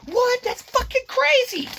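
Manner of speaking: mock shock. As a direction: squeal it out in fake outrage.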